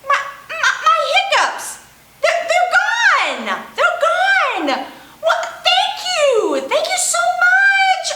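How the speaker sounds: high, laughing, delighted penguin; bubbling over with relief and joy; excited. Acted. Penguin thrilled hiccups gone.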